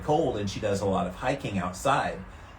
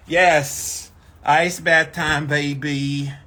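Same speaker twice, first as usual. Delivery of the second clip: hyped; 3 semitones higher, 7 dB louder, much slower than usual. Real, not acted. Hyping herself up.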